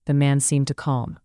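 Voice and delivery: ordinary delivery, nothing marked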